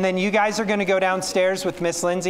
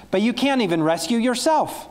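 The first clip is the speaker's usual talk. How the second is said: mild jeering, pitched up high; mocking shout; 8 semitones higher than usual. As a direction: sneer it up at the cross. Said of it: "people shouted".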